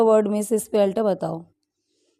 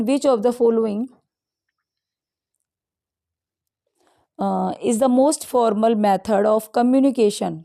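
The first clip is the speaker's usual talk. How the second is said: reading the answer options very slowly; teacher reading each option aloud, drawn out; slow quiz read-out; much slower than usual. Acted. Teacher reads options to exam students, slow and deliberate.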